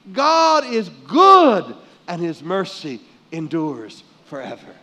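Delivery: loud, emphatic pulpit delivery; forceful preaching. Real, not acted. Preacher assuring congregation of the Father's goodness, forceful preaching. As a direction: drive each declaration home with force.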